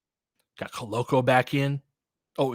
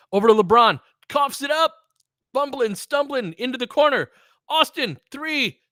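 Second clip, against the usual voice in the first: excited play-by-play; 10 semitones higher, 6 dB louder than usual. Real, not acted. Live play-by-play of a frantic possession.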